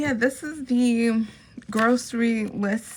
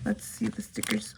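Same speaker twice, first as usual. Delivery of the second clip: whisper; 8 dB quieter than usual. Real, not acted.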